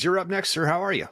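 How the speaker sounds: usual speaking voice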